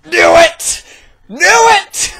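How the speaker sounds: high, loud crowing of triumph; gleeful shout. Acted. Gleeful friend shouts high and loud on hearing of the breakup. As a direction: she crows high and loud that she guessed it.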